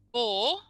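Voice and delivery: "Four" said without the f, like "or"; child's wrong four. Retold or imitated. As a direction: drop the f in "Four". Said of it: "that's not helpful for anyone".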